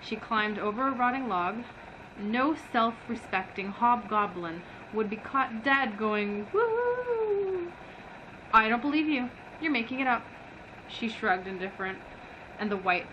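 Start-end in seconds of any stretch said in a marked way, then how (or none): none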